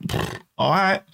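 mock sulky scoff; sulky child giving up. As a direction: shrug it off like a disappointed kid. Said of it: "I was like".

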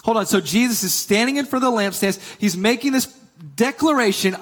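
ordinary talk

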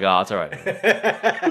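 slow, soothing "it's all right, man"; mock reassuring cop. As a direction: play a calm cop easing him. Cop's reassurance quoted.

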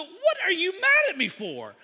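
high, loud protest; exasperated comic retort; mock indignant. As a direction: throw the accusation back, hammed-up. Retold quarrel, indignant reply.

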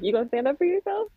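high-pitched, questioning prod; mock concern, pitched well up; teasing challenge. Wife asking beaten-up husband, high and incredulous.

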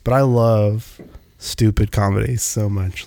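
usual speaking voice

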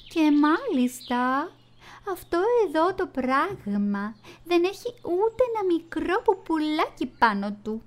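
high, slow little bird voice; a fussy small bird, stretched out high; goldfinch voice. Acted. Goldfinch scoffs at the bat, high and slow.

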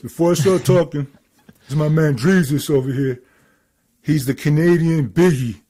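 put-on voice of a friend on the mic, loud; Ernie. Ernie introducing the rapper to the crowd, full impression. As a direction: play the proud hype man introducing his guest. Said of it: "let me get my Ernie voice".

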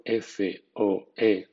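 each letter spelled slowly and apart; spelling out letters. Shown as a demonstration. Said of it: "por ejemplo". (deletreo lento)

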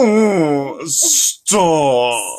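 hammed-up, swooping protest; parody wizard moan. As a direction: stretch each word out dramatically. Continuing Harry Potter parody video.

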